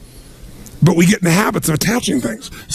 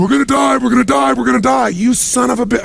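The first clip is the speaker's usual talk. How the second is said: mock panic; 7 semitones higher than usual. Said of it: "Other people".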